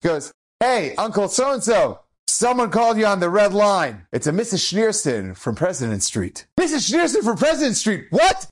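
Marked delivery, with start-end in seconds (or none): 0.6-6.5 breezy, too-cool announcement; Mister Cool. Retold or imitated. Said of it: "walking really cool, like Mister Cool". 6.6-8.5 high, shocked echo of the name; startled, high-pitched outburst; alarmed disbelief. Retold or imitated. Doctor's alarmed reaction to the message.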